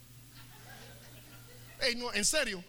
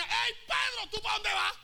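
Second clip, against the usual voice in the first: high, sharp call to Pedro; Mary Magdalene calling out; 10 semitones higher than usual. Acted. Mary Magdalene calls after Pedro, high and sharp. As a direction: bark it after him like a fed-up woman.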